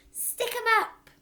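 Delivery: high, loud, slow hammed-up yell; mock yell. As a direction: pretend hold-up with a knife. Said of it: "she yelled".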